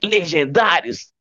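high, slow showman call; TV host announcement. Pretend. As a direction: announce it like a game show. Echoing TV show name.

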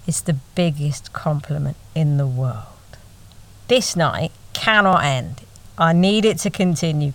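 normal talking voice

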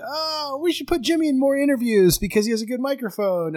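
high, gushing mock excitement. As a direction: ham up the group's amazed praise. Coworkers gushing over Jimmy's mic.

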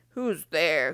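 low grumpy badger voice; deep, surly storybook growl. A narrator's reading. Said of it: "growled Badger".

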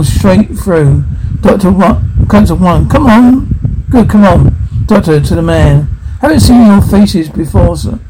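normal talking voice

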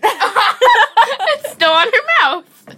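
high, loud, slow through laughter; laughing hard. Real, not acted. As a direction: crack up while saying it. Laughing at taped friend.

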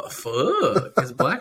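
words through laughter; laughing. Real, not acted. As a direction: laugh while saying it.

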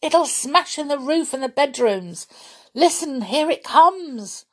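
high, urgent alarm; frantic impatience. A narrator's reading. Boy beside himself as tree falls, frantic. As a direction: warn them it is about to hit. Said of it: "almost beside himself with impatience".

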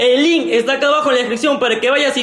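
high, forceful hard-sell shout; shouted promo. Pretend. Promoter urging fans to buy Tonazo tickets, high shouting. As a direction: yell it like an event advert.

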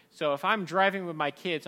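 normal talking voice

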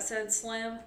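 ordinary delivery, nothing marked

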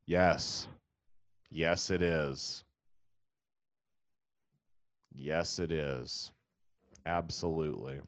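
slow, quiet, defeated yeses; weary deadpan. Real, not acted. Comic answering the clueless fan, slow weary deadpan. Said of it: "deadpan weary answer".

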